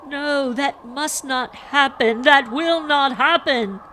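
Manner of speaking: high, loud, drawn-out pleading; anguished cry, slow and insistent; tearful outburst. A narrator's reading. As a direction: wail it in distraught protest. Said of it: "burst into uproarious tears".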